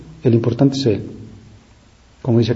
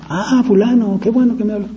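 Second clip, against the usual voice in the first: high-pitched, pleased recognition on the phone; delighted phone greeting; 10 semitones higher than usual. Acted. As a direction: voice jumps up, glad to hear who's calling. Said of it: "Ya cambió el tono de voz".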